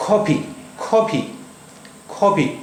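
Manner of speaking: कॉफ़ी with a clear f in the middle; careful demo. Shown as a demonstration. Teacher repeating the word for students. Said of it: "दूसरा word है".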